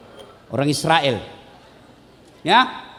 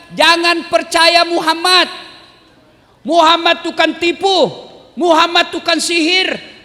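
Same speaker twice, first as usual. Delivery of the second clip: blind man shouting; 7 semitones higher than usual. Acted. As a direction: rail at the market crowd, voice pitched up. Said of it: "di pasar selalu teriak".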